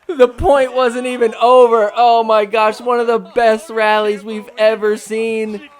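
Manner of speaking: loud, high, disbelieving commentary; stunned commentator at full volume; excited play-by-play outburst. Real, not acted. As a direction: shout the call over a shock blunder. Commentator reacting to a blown point.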